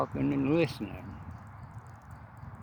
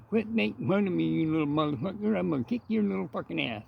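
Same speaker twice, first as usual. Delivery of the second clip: much higher, fast, cussing mock cleft-lip rant; angry old man threat; 7 semitones higher, faster than usual. Luke threatening Henry at the diner, high hammed-up cleft-lip fury. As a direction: spit out the threat at the rival. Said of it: "So I got Luke going".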